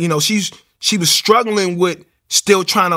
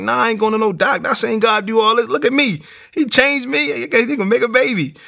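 insistent refusal; 6 semitones higher than usual. Retold or imitated. Husband to wife, refusing the doctor, fervently insistent. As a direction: dig in and preach it at her.

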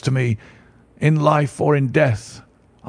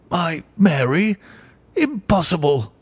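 shocked disbelief; 4 semitones higher than usual. Acted. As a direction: hit by unbearable news. Banker shocked at news.

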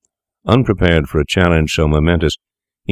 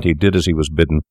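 plain reading voice

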